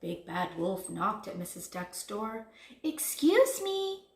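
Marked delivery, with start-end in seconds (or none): 2.8-4.1 high, slow, sugary politeness; sweetly. A narrator's reading. Big Bad Wolf at Missus Duck's door, sweet call. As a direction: sing it out sweetly. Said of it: "he said sweetly".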